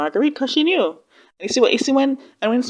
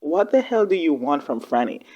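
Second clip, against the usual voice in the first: ordinary talk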